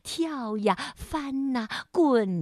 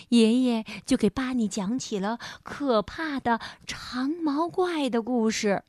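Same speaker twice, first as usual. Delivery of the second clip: ordinary delivery, nothing marked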